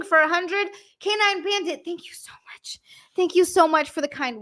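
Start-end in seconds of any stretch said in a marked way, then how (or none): 1.8-2.8 overwhelmed, breath-only whisper; whispered thanks. Real, not acted. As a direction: drop to a stunned whisper.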